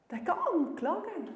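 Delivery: normal talking voice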